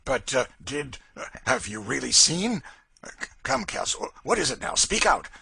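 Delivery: a hint of a bully losing his nerve; shaken Legree. A narrator's reading. Legree presses Cassie about the ghost, shaken and stumbling.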